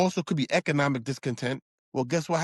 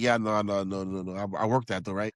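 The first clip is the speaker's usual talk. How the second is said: low mock inmate voice; 9 semitones lower than usual. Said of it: "He'd be like".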